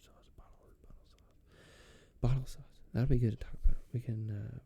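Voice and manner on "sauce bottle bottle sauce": breathy mock-secret whisper; whispered thoughts. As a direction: murmur it as if thinking aloud.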